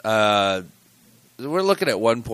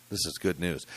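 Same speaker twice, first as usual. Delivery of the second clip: ordinary delivery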